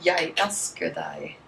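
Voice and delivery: Jeg elsker deg said slowly, yai ELS-ker dai; Norwegian pronunciation. Shown as a demonstration. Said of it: "how do you say it".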